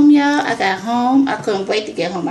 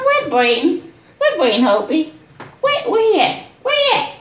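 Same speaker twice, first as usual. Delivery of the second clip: rising higher, calling where he at; urgent asking; 7 semitones higher than usual. Retold or imitated. Asking the crew for Braden, pitch climbing. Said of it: "I said".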